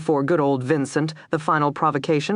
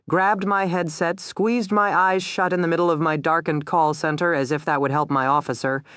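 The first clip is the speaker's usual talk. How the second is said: usual speaking voice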